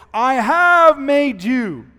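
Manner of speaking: high, loud, slow "I have made you", HAVE punched; stress on HAVE. Shown as a demonstration. Preacher proclaiming God's promise, high, slow, HAVE stressed. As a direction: boom out "have", every word drawn out. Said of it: "God spoke like this".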